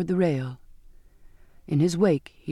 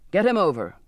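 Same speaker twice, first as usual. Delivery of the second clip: louder, clipped impatient order; impatient repeat; 3 dB louder than usual. A narrator's reading. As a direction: lose patience as you repeat the command. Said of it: "the captain repeated with impatience".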